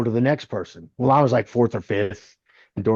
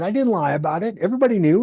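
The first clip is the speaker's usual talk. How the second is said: high-pitched self-defence; acted-out defensive protest; retold protest; 8 semitones higher, much faster than usual. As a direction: plead your innocence, voice climbing high. Defends himself in retold quarrel.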